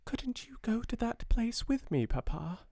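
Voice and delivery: put-on high, soft child's voice; small girl pleading. A narrator's reading. Sarah at five begging her father to come along, high and soft. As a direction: plead like a five-year-old.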